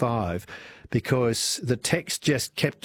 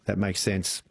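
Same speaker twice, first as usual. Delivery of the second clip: normal talking voice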